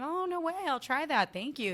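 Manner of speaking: high, loud, overly polite chirp; performed politeness. Acted. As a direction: turn on the chatty customer act. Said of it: "I'm gonna be polite".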